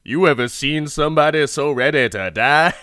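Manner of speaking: loud, hammed-up bad acting; guard's line read. Guard marvels at man ready to die, hammy line read. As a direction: overplay it, laughing at the end. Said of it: "I really love the guard's line read".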